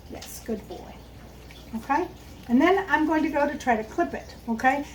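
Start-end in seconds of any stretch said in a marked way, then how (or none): 0.1-0.9 soft whispered praise, barely voiced. Real, not acted. Trainer quietly praising her dog, whispered. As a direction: breathe the praise gently to the dog.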